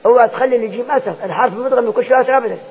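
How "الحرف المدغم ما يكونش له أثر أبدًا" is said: ordinary talk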